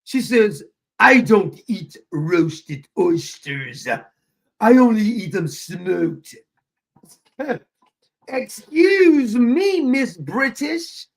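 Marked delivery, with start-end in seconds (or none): 1.0-6.4 raspy, indignant mock British, drawn out slowly; loud, slow put-on British indignation; Clever, indignant British. Imitating Clever answering about oysters, indignant raspy British. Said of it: "like, like, a raspy sounding voice". 8.3-11.1 high, loud, slow mock offence; mock affronted. Teasing Clever for turning British, mock affronted.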